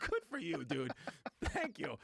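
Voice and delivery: cheering the driver on; amused approval. Retold or imitated. Passenger thanking the driver, high and amused.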